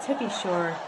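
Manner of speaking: tentative, soft Irish attempt; half-hearted Irish accent, amused. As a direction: try an Irish accent quietly.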